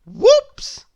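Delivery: high, loud squeal of whoops; squeaky whoops. A narrator's reading. Narrator squeals when eggs drop. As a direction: squeal it like a sudden slip. Sound-effect exclamation after accident.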